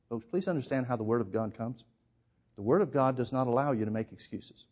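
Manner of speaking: ordinary delivery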